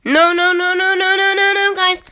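rapid string of high, loud no's; panicked protest. Real, not acted. Player to viewers as the game goes wrong, panicked protest.

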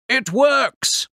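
high, excited exclamation; exclaiming. A narrator's reading. Inventor in the shed sees the machine work, exclaiming. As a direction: burst out in triumph. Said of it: "a voice exclaimed".

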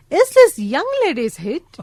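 high, slow, scornful granny imitation; drawn-out teasing old-lady voice; Angela. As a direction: swoop the pitch up in mock disbelief. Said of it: "तर म्हणाल्या की".

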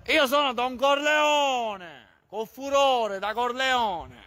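high, very slow mock mafia boss; Don Corleone. Streamer boasts to viewers as a hammed-up mafia boss, slowly. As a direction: declaim it like a hammed-up godfather. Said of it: "sai chi sono?".

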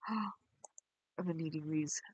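breathless slow whisper. Pretend. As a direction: gasp then whisper it out slowly. Gasping whisper joke.

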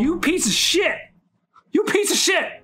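high-pitched, outraged squeal; exasperated cursing. Real, not acted. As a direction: curse your attacker in a high screech. Cursing attacker in game.

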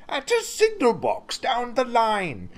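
high-pitched, agitated fire chief voice; urgent fire chief. Acted. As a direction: press the emergency on them.

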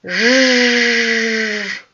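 "Ж" as a long voiced zhzh; beetle buzz. Shown as a demonstration. Teacher voicing the beetle's song, buzzing. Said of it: "яка ж пісенька лунає".

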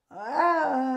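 sharp piercing cry, high and loud; high scream. Retold or imitated. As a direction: let out a sudden shriek. Woman screaming against mirror.

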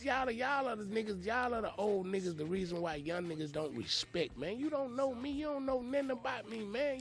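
put-on high, heated call-out of the old men; he's fed up and lets them have it; retold confrontation. Young man telling off the older cats gossiping about him, heated.

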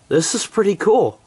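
excited. Real, not acted. Excited by heavy snow.

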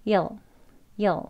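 "여", you'll as tiny "yuhl"; minimal, casual you'll, "여"; most reduced form. Shown as a demonstration. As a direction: say "여" as one very short weak syllable. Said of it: "더 간단하게 간소한 발음이죠".

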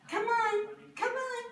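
high coaxing pet voice. Real, not acted. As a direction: sweet-talk the cat down. Coaxing the cat.

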